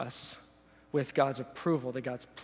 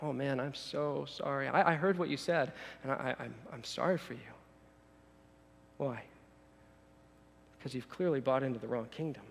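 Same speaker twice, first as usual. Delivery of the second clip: condescending pity; 2 semitones lower, 6 dB quieter than usual. Acted. Jesus replying to the self-made man, quiet pity. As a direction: break the bad news quietly, with pity. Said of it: "he's much more condescending than that".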